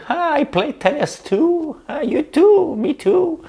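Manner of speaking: very high, gushing falsetto; big smile. Retold or imitated. Buttering up the tennis-playing interviewer, big smiling falsetto. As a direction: squeal it slowly through a huge put-on grin. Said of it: "avec un grand sourire".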